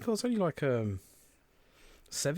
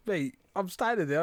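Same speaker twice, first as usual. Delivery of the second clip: normal talking voice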